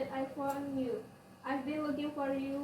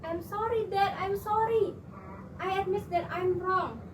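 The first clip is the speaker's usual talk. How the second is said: raised, louder begging; pleading; 5 semitones higher, 4 dB louder than usual. Acted. Daughter begging dad not to throw her out, pleading. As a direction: plead urgently in a higher voice.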